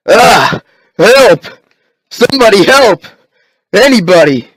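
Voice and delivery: high, loud terrified cries; panicked screams for help. Acted. Man grabbed by a walker, screaming high and loud. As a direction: scream for your life.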